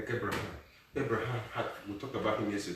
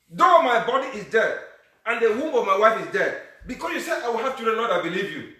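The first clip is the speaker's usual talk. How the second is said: fervent preaching; 10 semitones higher, 9 dB louder than usual. Acted. Pastor voicing Abraham's praise, loud fervent preaching. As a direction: preach Abraham's thanksgiving with fire.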